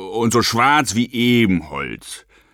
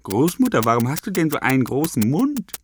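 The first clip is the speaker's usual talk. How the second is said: put-on little girl asking; 4 semitones higher, 3 dB quieter, faster than usual. A narrator's reading. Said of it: "fragte Rotkäppchen weiter".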